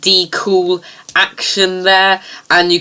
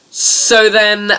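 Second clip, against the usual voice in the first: usual speaking voice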